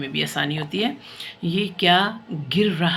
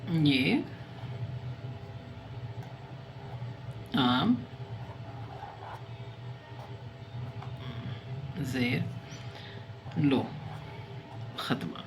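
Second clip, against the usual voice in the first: slow dictation; 2 semitones lower, 10 dB quieter, much slower than usual. Shown as a demonstration. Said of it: "dictation of a sentence".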